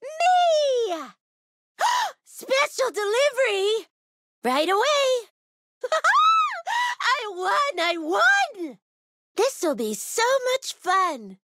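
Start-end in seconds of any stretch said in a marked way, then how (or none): 0.0-1.1 high, drawn-out proud cry; gleeful self-announcement. Acted. Announcing herself as the winner. 5.8-6.9 high squealing victory laugh; delighted laugh. Acted. Delight at having won.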